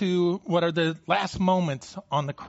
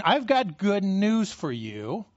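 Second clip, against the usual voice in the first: slow proclamation; 3 semitones higher, slower than usual. Acted. Preacher voicing Mark's good news, slow and deliberate. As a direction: stretch out "I've got good news for you" slowly, word by word. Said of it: "He's saying".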